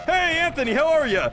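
high, exaggerated hello; mock cheery greeting. Streamer clowning a greeting to Anthony, high and over-cheery.

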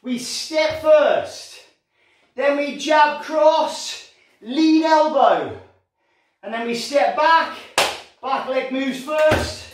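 slow, deliberate step-by-step explanation; slow demonstration. Shown as a demonstration. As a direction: talk them through each move slowly. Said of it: "So watch".

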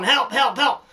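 mock cry for help. Host retelling the inspector fleeing the bull, mock yelling. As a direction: play the panicked inspector again. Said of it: "He's yelling".

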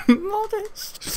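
repeats the word through a high laugh; giggling repeat. Shown as a demonstration.

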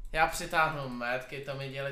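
ordinary talk, nothing marked

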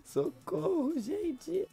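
high squeaky cry of alarm; mock alarm. Reacting to friends' comments, a high pretend squeal.

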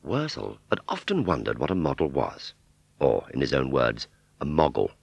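ordinary delivery, nothing marked

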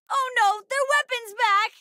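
high, panicked battle warning; urgent, high-pitched call-out; alarmed cry. Acted.

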